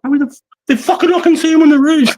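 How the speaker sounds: very high, fast, laughing urgent cry; frantic mates. Retold or imitated. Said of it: "They're like".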